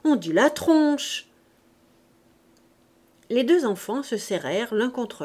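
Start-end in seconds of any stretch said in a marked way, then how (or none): 0.0-1.3 higher, louder, slow insistence on tronche; emphatic gamin correction; Gavroche crying out. A narrator's reading. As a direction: call out the slang word. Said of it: "cria Gavroche".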